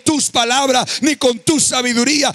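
full-force preaching. Acted. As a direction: drive the message home.

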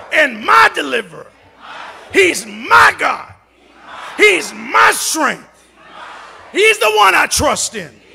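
loud, slow, every "my" punched hard; bold declaration. Shown as a demonstration. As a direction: shout each line as a defiant declaration. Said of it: "declare with me right now with an attitude".

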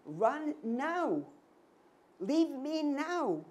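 high, loud, drawn-out plea to flee; urgent stretched command from a frightened mother; mother's look begging her child to run, loud and high; desperate urging. Retold or imitated. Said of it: "my mother is giving me the look".

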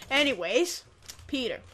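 usual speaking voice

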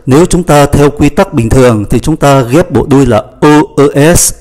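normal talking voice

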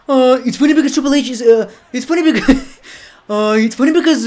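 loud, high, rushed wrestler catchphrase. Pretend. Commentator mimics a wrestler mid-match, hammed-up impression. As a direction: put on a hammed-up wrestler voice, breaking into laughs. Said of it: "or was that [name]?".

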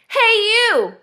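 high, loud grouchy call; cranky storybook bug; grouchy ladybug. A narrator's reading. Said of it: "said the grouchy ladybug".

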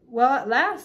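loud, impatient little exclamation; lightly voiced tiny character, emphatic. A narrator's reading. Tiny king as the lamp finally lights, impatient relief. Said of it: "said the tiny voice".

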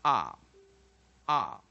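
"ah" loud, open and isolated; loud teaching demo of "ah", repeated with a gap; short O vowel. Shown as a demonstration. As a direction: punch out "ah" as a vowel drill. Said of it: "Short vowel, O".

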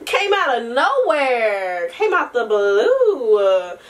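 usual speaking voice

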